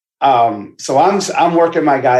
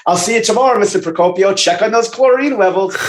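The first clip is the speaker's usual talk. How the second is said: higher, sing-song mock pool boy; lightly voiced pool boy, pitched higher; smug pool boy; 6 semitones higher, faster than usual. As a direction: lampoon a cocky pool boy leaving the house. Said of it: "That was the pool boy fucking my wife and saying".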